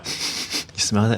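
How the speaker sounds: slow, breathy whisper; whispered sniff question. Retold or imitated. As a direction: hush it like sniffing out a secret. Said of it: "I'm like".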